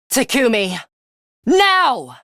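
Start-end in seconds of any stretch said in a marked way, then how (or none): 1.4-2.2 sharp high call of now; battle command. Acted. Signalling Takumi to attack, sharp high shout. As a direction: yell the signal to strike.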